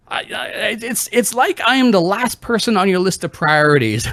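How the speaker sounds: high-pitched wounded complaint; aggrieved mom. Retold or imitated. Mom accusing her child over priorities, high aggrieved imitation.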